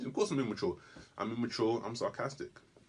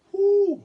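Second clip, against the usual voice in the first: loud high whoop of disbelief; whoo; 12 dB louder than usual. Real, not acted. Comedian reacting to the C word, loud high whoop. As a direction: let out a big rising whoo.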